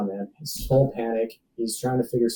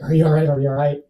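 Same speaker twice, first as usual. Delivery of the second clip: mock-frantic friend, higher and louder; imitating urgent concern; 4 semitones higher, 6 dB louder, faster than usual. Friend checks on him after the fire, imitated urgent concern.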